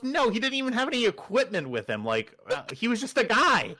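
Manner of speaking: high, agitated mock outburst; exasperated denial. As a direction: imitate a flustered engineer insisting. Engineer's exasperated retold answer.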